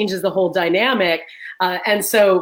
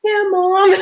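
put-on higher, eager teen daughter; 11 semitones higher, much slower than usual. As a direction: play the daughter jumping to help. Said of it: "my daughter's like".

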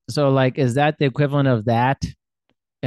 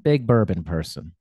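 normal talking voice

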